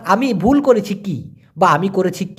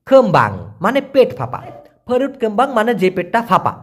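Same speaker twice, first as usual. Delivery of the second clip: normal talking voice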